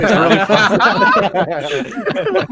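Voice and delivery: laughs through it; giggling while talking; laughing. Real, not acted.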